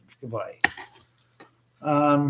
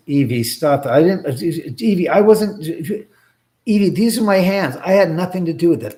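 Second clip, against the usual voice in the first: protesting innocence; 5 semitones higher, 6 dB louder than usual. Real, not acted. Denying blame to Evie.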